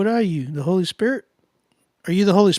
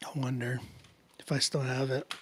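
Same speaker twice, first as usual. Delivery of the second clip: whisper; 4 semitones lower, 10 dB quieter than usual. Real, not acted. Searching quietly for a beat.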